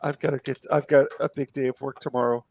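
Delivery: normal talking voice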